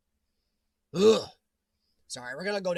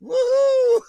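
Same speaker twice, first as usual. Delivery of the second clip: loud whoop; 11 dB louder than usual. Real, not acted. Cheering at being found online.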